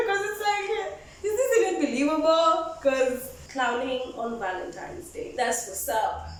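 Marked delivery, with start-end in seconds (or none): none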